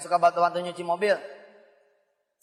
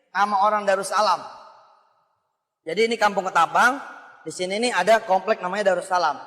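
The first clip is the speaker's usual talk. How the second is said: normal talking voice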